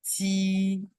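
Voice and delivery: "Si" high, long and level; sustained, unbending high "Si"; first tone. Shown as a demonstration. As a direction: hold "Si" on one high note. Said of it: "le ton constant, le premier ton".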